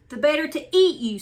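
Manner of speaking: wicked wolf's loud snarl. Acted. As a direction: snarl it as you pounce. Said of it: "snarled the wicked old wolf".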